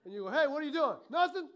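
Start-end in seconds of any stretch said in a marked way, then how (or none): 0.3-1.0 loud, sudden challenge; parent calling out. Acted. Parent to child in timeout, loud challenge. 1.1-1.5 high, loud, quick protest; child's denial. Acted. Child in timeout answers parent, high loud denial. Child caught muttering denies it.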